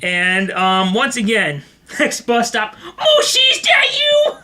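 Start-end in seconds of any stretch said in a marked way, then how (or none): 2.8-4.4 high-pitched mock holler; crying out. Man calls at the next bus stop, crying out. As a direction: imitate a deluded man calling out. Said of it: "he's crying for Mushi".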